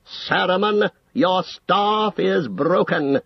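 slow, high, deliberate pronouncement; slow, clear, cold. A narrator's reading. Gandalf breaking Saruman's staff, cold and slow. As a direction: pass sentence slowly, each word distinct. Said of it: "spoke slowly in a clear, cold voice".

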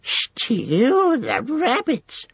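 very high, drawn-out creature squeal; dismayed squeal. A narrator's reading. Gollum hearing Sam will stew the rabbits, dismayed squeal. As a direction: squeal it slowly in horror. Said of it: "squealed Gollum in dismay".

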